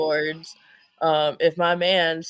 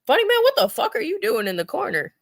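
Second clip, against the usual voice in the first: teasing high call; 11 semitones higher, faster than usual. Real, not acted.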